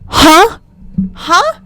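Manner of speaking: loud, high demanding huh; incredulous huh. Real, not acted. Reviewer challenging the viewer, loud high incredulous huh. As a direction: shoot it up sharply, demanding an answer. Demanding answer, in mock outrage.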